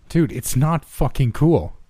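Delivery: high, slow, emphatic scolding; heated protest, each word leaned on; angry yell. A narrator's reading. Tanner confronting the office prankster, high angry yell. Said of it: "Tanner yelled".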